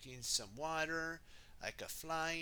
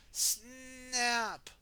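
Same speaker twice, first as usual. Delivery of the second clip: high, punchy snap; sharp snap sound; 9 semitones higher, 4 dB louder than usual. Acted. The gnome's nose breaking, punchy high snap. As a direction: crack it out like breaking stone.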